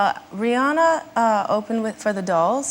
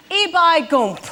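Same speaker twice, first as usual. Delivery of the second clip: put-on rough Yorkshire accent, higher pitched; 5 semitones higher than usual. Guest repeats the first half for the host, attempted Yorkshire accent. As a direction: mimic Yorkshire by ear. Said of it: "That's a Yorkshire accent".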